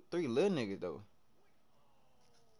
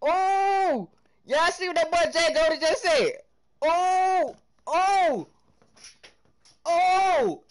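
high, loud hollering at chat; hype reaction; 12 semitones higher, 6 dB louder, slower than usual. Real, not acted. Streamer reacting to a viewer's message, loud excited hollering. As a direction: holler like the chat just blew up.

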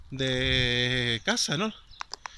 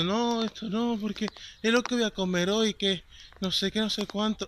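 higher-pitched imitation of a worried elderly woman; protesting old lady; 6 semitones higher than usual. Old lady objecting as her food is taken, imitated pleading =33. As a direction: protest in a raised little voice. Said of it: "esto es la señora diciendo".

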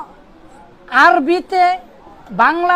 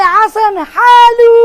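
put-on loud, higher, drawn-out Omani accent; 6 semitones higher, 7 dB louder, much slower than usual. Teacher imitating his Omani roommate's greeting, Omani accent. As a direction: stretch the vowels like an Omani on a call. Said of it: "চার বছরের roommate Omani".

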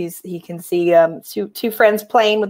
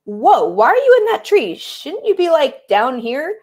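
put-on high, incredulous young camper; questioning bear; 7 semitones higher, slower than usual. Bear asking the cat in the tree, high puzzled voice. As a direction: question it in a raised voice, slowing at the end. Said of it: "And the bear is saying".

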